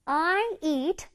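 very slow, words drawn out; slow model reading. Shown as a demonstration. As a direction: read slowly for the class to copy.

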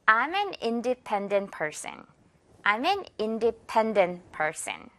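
I'm an independent person, slow and clear; slow model sentence. Shown as a demonstration. Teacher modelling the sentence twice, very slow. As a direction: spell it out slowly for the class. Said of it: "independent 이란 단어 기억해주면 돼요".

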